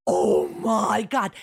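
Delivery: slow, loud, drawn-out shock; stunned oh my God. Retold or imitated. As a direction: let the realization land slowly. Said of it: "I was like".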